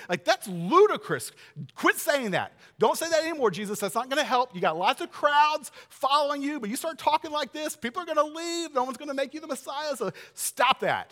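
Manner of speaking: loud, high-pitched exasperated tirade; Peter's forceful rebuke. Acted. Peter setting Jesus straight, forceful rebuke. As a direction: scold urgently, building to a hard final order. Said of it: "used the strongest possible language".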